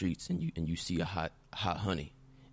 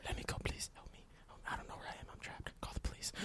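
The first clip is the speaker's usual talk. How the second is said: desperate hushed plea; whispering; 13 dB quieter, faster than usual. Acted. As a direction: whisper it so nobody hears. Hostage whispering for help.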